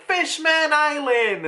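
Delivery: put-on high, drawn-out kid's cheer; stretched, high-pitched delight; excited exclamation. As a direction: call it out like a thrilled child. Girl shouts her birthday wish.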